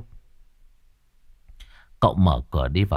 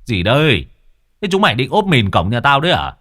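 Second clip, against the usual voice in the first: shout; 4 semitones higher, 6 dB louder than usual. A narrator's reading. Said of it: "kêu oai oái".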